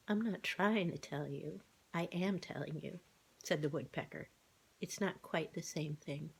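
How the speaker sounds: the bird corrects the cat, quiet and precise; lightly voiced fussy bird; prim woodpecker. A narrator's reading. Woodpecker character voice.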